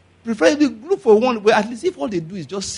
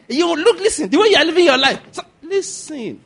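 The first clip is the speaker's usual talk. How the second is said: raised, higher scolding voice; scolding brother; 9 semitones higher, 4 dB louder than usual. Retold or imitated. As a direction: scold the wayward brother hard. Arguing with gang brother.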